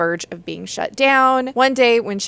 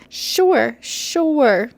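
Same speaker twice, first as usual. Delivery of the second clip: sarcastic; 5 semitones higher, 4 dB louder than usual. Real, not acted. Sarcastic about rosy synopsis line.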